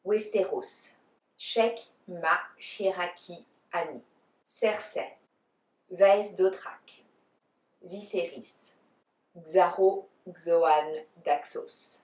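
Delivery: names pronounced in a French accent. Shown as a demonstration. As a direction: say each name the French way. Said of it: "try to do it the French way".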